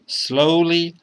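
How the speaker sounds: usual speaking voice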